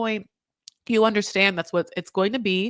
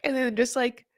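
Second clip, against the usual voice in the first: ordinary talk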